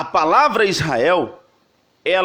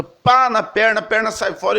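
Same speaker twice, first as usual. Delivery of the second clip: usual speaking voice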